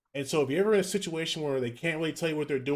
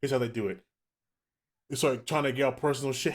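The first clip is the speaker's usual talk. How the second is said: normal talking voice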